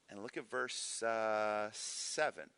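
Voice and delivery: ordinary delivery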